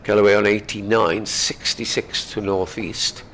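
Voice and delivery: ordinary talk, nothing marked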